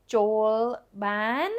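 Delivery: "Joel ban" asked with rising tune on "ban"; rising question. Shown as a demonstration. Teacher mistakenly models "Joel ban" as a rising question. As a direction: lift "ban" up as if asking. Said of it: "would be a question".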